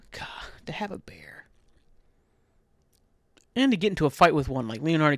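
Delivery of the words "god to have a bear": dreamy longing whisper. Real, not acted. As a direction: sigh it out as a wish. Longing for a pet bear.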